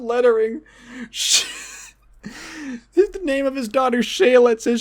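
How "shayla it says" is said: ordinary delivery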